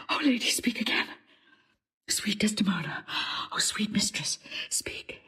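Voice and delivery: urgent whisper. Acted. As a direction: whisper over the dying woman. Whispering over dying lady.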